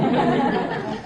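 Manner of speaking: a breathy little laugh at his own story; soft laugh. Real, not acted. Speaker laughing at his childhood memory, soft chuckle. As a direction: let a quiet chuckle slip out.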